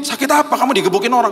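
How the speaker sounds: animated, incredulous grilling; exasperated questioning. Retold or imitated. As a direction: demand to know what on earth hurts. Preacher questions his wailing friend.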